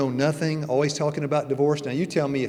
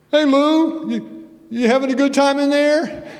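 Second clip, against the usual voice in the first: much higher, louder teasing call; mock Adventist friend; 12 semitones higher, 7 dB louder than usual. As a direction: tease Lou like a cheery churchgoer. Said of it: "one of you Adventists say".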